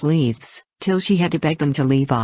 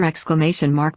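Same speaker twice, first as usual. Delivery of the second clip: usual speaking voice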